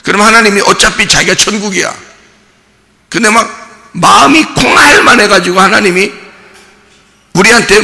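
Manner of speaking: high, loud, impassioned preacher's voice; fiery preaching. Acted. Preacher urging the congregation about heaven, fired up and loud. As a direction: preach it out to the whole hall.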